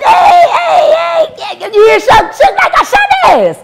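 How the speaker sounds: gleeful hey hey yelling; calling out. Retold or imitated. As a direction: holler the news across the room. Retold calling out about Suge.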